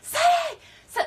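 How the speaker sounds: high frantic screaming of a name; retold scream. Geneva calling for help from the balcony, high scream. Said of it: "Geneva's screaming".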